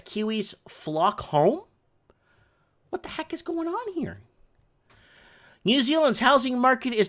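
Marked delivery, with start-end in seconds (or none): 2.9-4.2 high, soft incredulous voice; mock disbelief. Real, not acted. Reacting to the headline in high mock disbelief. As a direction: act baffled by the headline.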